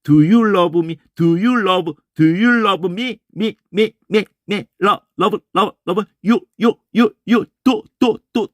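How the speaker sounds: "Do you love me?" chopped with hard, pushed consonants; forced consonants. Shown as a demonstration. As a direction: hammer each word of "Do you love me?" like a washboard, tu-jul-obu-mi. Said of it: "이렇게 힘 들어가거든요".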